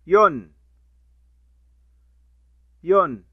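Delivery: ين said as a short loud "un" ending; tanween sound. Shown as a demonstration. Teacher drilling damma tanween, loud. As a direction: repeat ين firmly as the nunation "-un". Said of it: "تنوين".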